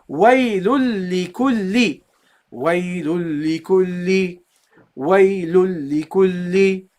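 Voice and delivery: "Waylul li kulli" slowly, both lams held double; model pronunciation. Shown as a demonstration. Teacher shows how you should pronounce it, doubled lams. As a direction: chant out "Waylul li kulli" slowly, lingering on each l. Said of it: "That's how you should pronounce it".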